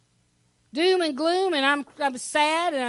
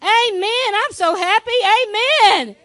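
loud, high, jubilant demonstration; exuberant, building to a loud finish; happy amen; 6 semitones higher, 8 dB louder than usual. Shown as a demonstration. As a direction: model an enthusiastic amen for the congregation. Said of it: "Maybe we need to practice again".

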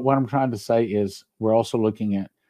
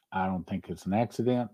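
ordinary delivery

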